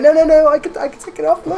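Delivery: very high, loud panicky pleading; panicked protest. Retold or imitated. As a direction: squeal in mock panic at the saw. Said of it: "and I was like".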